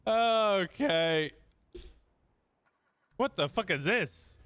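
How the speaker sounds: high, loud disbelieving shout; incredulous outburst. Real, not acted. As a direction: yell in outraged disbelief. Finds car blocked.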